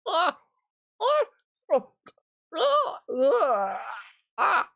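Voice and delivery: short, piercing high shrieks, one after another; startled screams. Real, not acted. Streamer yelping in fright before the trailer, high shrieks. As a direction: let out sharp high yelps of alarm.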